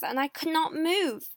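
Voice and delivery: ordinary delivery, nothing marked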